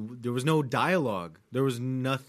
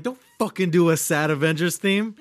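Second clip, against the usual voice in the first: mock anger; 4 semitones higher, 6 dB louder than usual. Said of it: "you getting mad".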